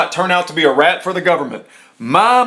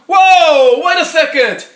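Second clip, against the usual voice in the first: 1 surprised; 9 semitones higher, 4 dB louder, slower than usual. Real, not acted. Sudden realization while reading.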